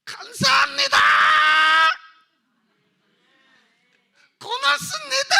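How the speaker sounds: high, loud cry of thanks to God; tearful shout of thanks; shouted thanksgiving. Real, not acted. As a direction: cry out gratitude to heaven.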